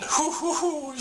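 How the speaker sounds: high laughing owl hoots; owl hooting. Acted. Doctor Owl joining the song with happy high hoots. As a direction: hoot like a cheerful owl, high up. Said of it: "said Doctor Owl".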